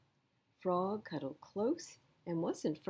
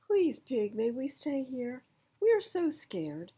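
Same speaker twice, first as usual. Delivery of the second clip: pleading; 7 semitones higher, 4 dB louder than usual. A narrator's reading. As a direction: beg timidly. Begging to stay, scared.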